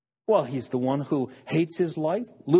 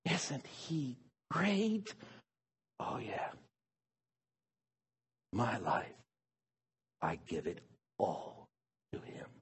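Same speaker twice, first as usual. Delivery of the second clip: hushed devotion; 4 semitones lower, 10 dB quieter than usual. Pretend. Worshipper quietly surrendering his life to Jesus, hushed. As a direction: give yourself away in a hush. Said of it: "we say".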